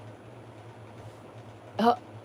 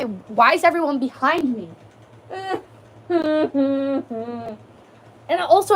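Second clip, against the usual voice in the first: usual speaking voice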